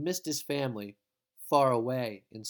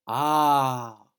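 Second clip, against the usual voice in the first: rapturous sigh; 8 dB louder than usual. Acted. Jose voiced in rapture.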